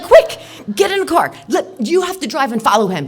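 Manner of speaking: barking orders to chase; loud urgent orders; frantic shout. Retold or imitated. Ordering boyfriend to chase him.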